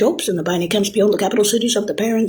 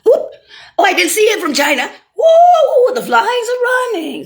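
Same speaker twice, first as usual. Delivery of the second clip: mock amazement; 13 semitones higher, 3 dB louder than usual. Mocking quote of a reaction.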